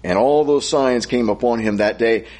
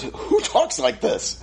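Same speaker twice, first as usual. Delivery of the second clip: high, slow, incredulous put-on voice; mock puzzled kid; 9 semitones higher, 3 dB quieter, slower than usual. Kid reading Shakespeare asking who talks like this, mock baffled. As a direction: play a baffled sixth grader. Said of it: "what I always said is".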